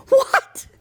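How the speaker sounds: very high, loud incredulous what; shocked what. Retold or imitated. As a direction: yelp it out in disbelief. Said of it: "I'm like".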